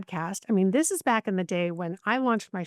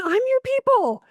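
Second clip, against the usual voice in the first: put-on much higher and louder, an indignant little kid; childlike protest; 6 dB louder than usual. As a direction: insist like a hurt small child.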